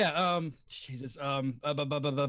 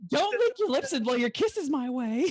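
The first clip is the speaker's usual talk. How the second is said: hammed-up genie; 5 semitones higher than usual. Genie riffing on a pop song, hammed-up and high. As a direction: keep up the showy high genie voice.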